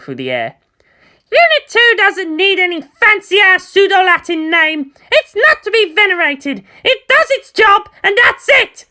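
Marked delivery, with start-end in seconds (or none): 1.3-8.9 put-on much higher and louder brash teen girl; proud, defiant outburst, building to a shout; fiery girl pilot. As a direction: Asuka brushing off fancy names, rising to a yell. Asuka voiced as character.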